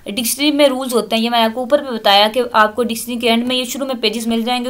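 ordinary delivery

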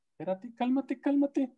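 high, soft pleading espérate; panicky protest. Retold or imitated. User told to change tools, protesting in a high squeak. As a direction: squeak out a frightened calm down. Alarmed reaction quoted.

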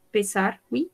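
ordinary talk

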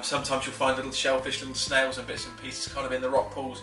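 usual speaking voice

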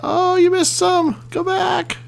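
very high-pitched cheering; excited commentary. Real, not acted. Player cheers his machine at the archers, excited. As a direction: squeal directions at your charging machine.

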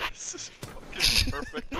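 laughing delight. Real, not acted.

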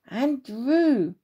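mother's sharp rebuke of her son; a touch of a cross mum; exasperated scold. Acted. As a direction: tell him off with one word. Mum finds nothing in tree.

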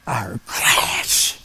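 whispered, very slow and dragging. Acted. Storyteller revealing the broken window, slow whisper. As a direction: let the word crash drop slowly in a whisper.

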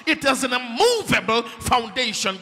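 high, loud shouted declaration, fast at the end; preacher hitting the climax; full-force preaching. Real, not acted. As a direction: shout the truth out to the congregation.

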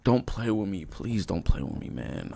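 quiet, low, begging under breath; low pleading mutter, half to himself; hushed plea to the game. Real, not acted. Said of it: "I'm not in the mood to be played with".

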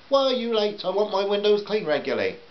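high, put-on complaining customer; high-pitched scolding housewife; demanding housewife. A narrator's reading.

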